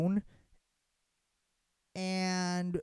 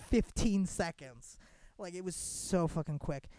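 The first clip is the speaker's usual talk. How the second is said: ordinary talk, nothing marked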